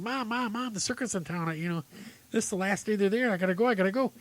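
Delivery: put-on high-pitched, urgent kid pleading; excited little boy. Boy begs mom to see the circus, excitedly. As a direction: play a boy bursting to get out the door. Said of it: "all excited".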